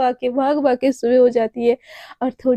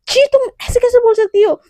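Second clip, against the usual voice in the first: disgusted; 9 semitones higher, 7 dB louder than usual. Acted. Naina disgusted by remark.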